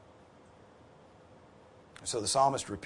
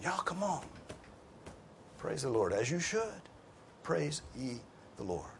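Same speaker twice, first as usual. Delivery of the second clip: softer, lifted invitation to join in; coaxing call to others; 5 semitones higher, 7 dB quieter than usual. Acted. Psalmist calling everybody else to praise, gently urging. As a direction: urge them along, pitch rising, without shouting. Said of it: "This guy's calling everybody else".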